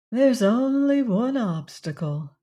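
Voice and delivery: ordinary delivery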